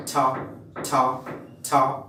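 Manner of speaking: ছা with a hard burst of breath; sound demo. Shown as a demonstration. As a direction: spit the ছা out firmly. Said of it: "টি এর sound সিস্টেমটা হচ্ছে". (জোরে ছা উচ্চারণ)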